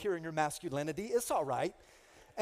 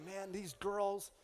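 weary aside; 4 dB quieter, much slower than usual. Retold or imitated. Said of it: "I was like".

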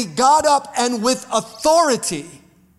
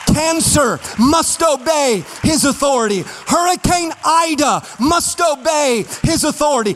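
rising, driving sermon cadence, louder and higher; preaching at full force; 4 semitones higher, 4 dB louder than usual. Acted. Pastor rallying the congregation on Jesus' authority, fervent preaching. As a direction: build the congregation up line by line.

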